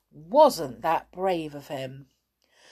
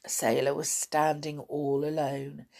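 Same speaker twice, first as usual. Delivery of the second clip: ordinary delivery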